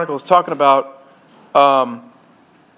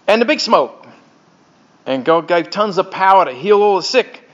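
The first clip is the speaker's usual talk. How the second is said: put-on Australian storyteller; Aussie accent; 2 semitones higher than usual. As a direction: tell it in a hammed-up Aussie accent. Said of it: "I'll do the best I can with this".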